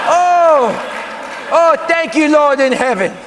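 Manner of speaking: high, loud laughing relief. Real, not acted. High loud thanks to heaven. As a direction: hears the letter is lost, cries out thanks. Huge relief letter lost.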